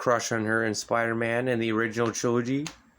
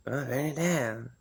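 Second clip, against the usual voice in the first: burn it down; 5 dB quieter than usual. Retold or imitated. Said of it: "I like the way he was like".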